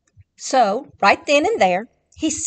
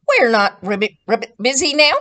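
frog voice; 7 dB louder, faster than usual. Acted. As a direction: croak it like a busy frog. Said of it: "answered the frogs".

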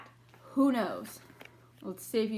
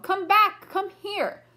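calling out; 6 semitones higher, 5 dB louder, slower than usual. A narrator's reading. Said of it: "I called".

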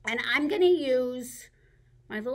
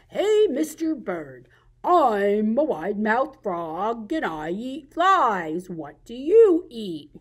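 slow, loud, stretched frog voice; wide-mouthed frog; 7 dB louder, slower than usual. Acted. Frog hails Mister Bird, slow loud frog voice. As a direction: hail and ask with big drawn-out vowels. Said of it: "he ran into a bird".

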